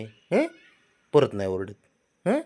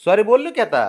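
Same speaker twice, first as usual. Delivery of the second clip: coaxing apology; 12 semitones higher, 7 dB louder than usual. Real, not acted. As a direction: plead your apology in a raised, coaxing pitch. Father coaxing sulking child. (उंच आवाजात लाडीगोडीने माफी)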